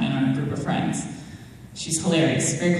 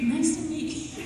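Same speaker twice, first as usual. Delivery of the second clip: tiny, very high and soft mimicry; changed starstruck voice; 11 semitones higher, 6 dB quieter than usual. Friend introduced to her favourite actor, changed starstruck voice. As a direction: imitate a starstruck friend squeaking softly. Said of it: "Her voice changed. She went like this".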